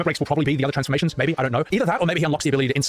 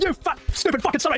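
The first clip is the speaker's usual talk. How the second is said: much higher mock rage at Shira; much higher hammed-up rant; raging Sid; 13 semitones higher than usual. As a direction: ham up a furious hot-headed pilot. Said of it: "He's like".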